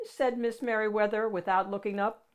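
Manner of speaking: ordinary delivery, nothing marked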